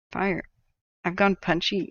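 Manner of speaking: ordinary talk, nothing marked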